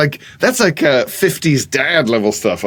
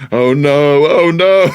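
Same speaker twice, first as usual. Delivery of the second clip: higher, louder panic; mock cry; 6 semitones higher, 5 dB louder, much slower than usual. Teenager faking deafness, crying out. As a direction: cry out in fake alarm. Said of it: "he cried out".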